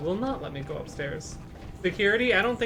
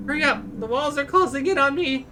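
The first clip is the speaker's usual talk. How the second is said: mock panic; 9 semitones higher, 7 dB louder than usual. Trapped in elevator, pleading.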